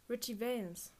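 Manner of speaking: plain reading voice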